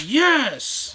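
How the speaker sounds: high, loud burst of joy; a sudden yelp of triumph; excited cheer. Retold or imitated. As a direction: cheer like your team just scored. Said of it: "I'm sitting there like".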